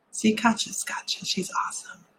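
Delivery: soft whisper; whispered aside. Real, not acted. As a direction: whisper it so nobody's disturbed. Aside during group meditation.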